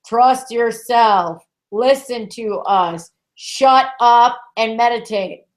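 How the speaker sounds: slow, separated imperatives; firm orders. Retold or imitated. Guides telling people what to do, firm. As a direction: spell it out slowly, one command at a time.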